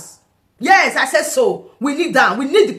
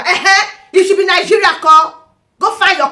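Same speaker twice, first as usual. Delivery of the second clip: raised, louder retort; loud challenge; 6 semitones higher, 6 dB louder than usual. Real, not acted. Ranting vlogger loudly daring critics who claim Nigeria. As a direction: call out the critics with a loud dare.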